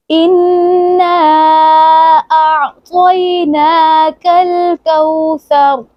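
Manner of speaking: slow, high chanted इन्ना अअतयनाकल Kausar; tajweed recitation. Shown as a demonstration. Teacher leads practice recitation, slow and chanted. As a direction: intone each syllable long and melodic. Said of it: "पूरे फिक्रे को मिलाकर पढ़ते हैं".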